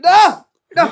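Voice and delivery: urgent shout to stop; calling out angrily. Acted. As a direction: yell after someone running off. Chasing after someone running away. (ദേഷ്യത്തോടെ വിളിച്ചുകൂവുന്നു)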